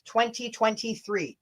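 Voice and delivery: normal talking voice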